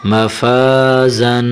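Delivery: each syllable of مفازن drawn out slowly; slow drawn-out reading. Shown as a demonstration. Teacher reading مفازن slowly to children. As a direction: spell مفازن out slowly for the pupil. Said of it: "زا کو سختی کے ساتھ ادا کرنا ہے".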